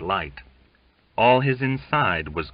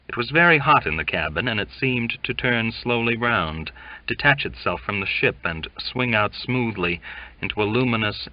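ordinary delivery

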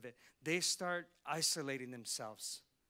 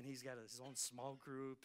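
ordinary talk, nothing marked

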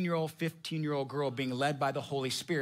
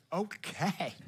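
meek agreement; 2 semitones higher than usual. Retold or imitated. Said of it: "Abraham's like".

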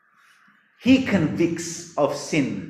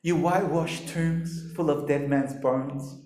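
slow, soft, lowered voice; Jesus, mock gentle; 4 semitones lower, 8 dB quieter than usual. Shown as a demonstration. Preacher doubting Jesus rebuked hypocrites this way, mock gentle. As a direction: deliver the condemnation as if soothing. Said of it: "He was talking like that".

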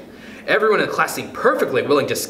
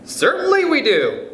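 high, indignant class yell; collective yell; 13 semitones higher, 3 dB louder than usual. A narrator's reading. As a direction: yell it back like a whole class. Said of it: "yelled one and all".

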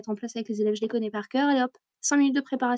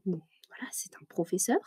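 whispered aside; 3 semitones lower, 6 dB quieter than usual. Real, not acted. Gossipy aside about colleagues.

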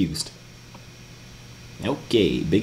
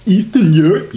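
put-on louder, higher parody of an Eastern European accent; mocking Eastern European accent; 5 semitones higher, 4 dB louder than usual. Mocks the other's accent.

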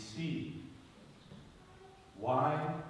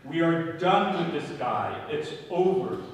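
louder, higher voicing of the crowd; emphatic quoted verdict; 5 semitones higher, 10 dB louder than usual. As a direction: declare it firmly, as if quoting a crowd.

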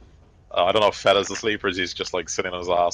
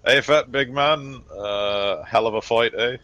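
slightly hammed-up matey hail, higher and drawn out; higher, slow opening on a chummy greeting; hearty greeting; 4 semitones higher, slower than usual. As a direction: greet a big pal after a fight. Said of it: "slaps you on the shoulder and goes".

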